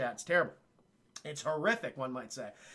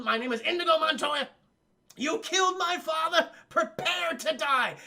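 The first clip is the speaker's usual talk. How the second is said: higher and louder, the last words slowed; escalating battle cry; 10 semitones higher, 5 dB louder than usual. Retold or imitated. Indigo stabbing the six-fingered man, yelling his line. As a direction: hurl the famous line at him again. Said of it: "he keeps saying the line over and over".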